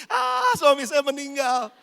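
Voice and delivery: loud, drawn-out mock wail; mock wailing widow. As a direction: wail theatrically like a bereaved wife, opening on a long cry. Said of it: "terus istrinya bilang gini".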